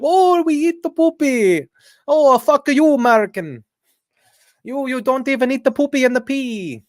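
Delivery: botched Indian accent mocking Americans; bad mock Indian accent. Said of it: "I can't do an Indian. That just sounded like Italian".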